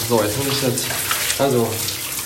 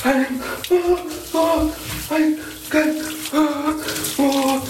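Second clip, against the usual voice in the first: sharp high gasps in icy water; gasping from cold; 9 semitones higher, much slower than usual. Real, not acted. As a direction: gasp for air, shocked by cold. Gasping under ice-cold water.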